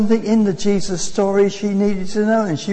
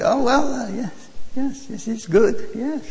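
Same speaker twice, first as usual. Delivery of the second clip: higher-pitched imitated Eastern European accent; 5 semitones higher than usual. Preacher quotes curry-eating fellow passenger, Eastern European accent. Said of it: "Eastern European accent, she said".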